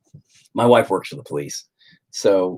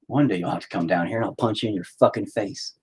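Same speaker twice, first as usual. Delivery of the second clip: ordinary delivery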